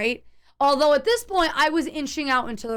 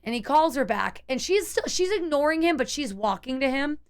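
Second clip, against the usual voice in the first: normal talking voice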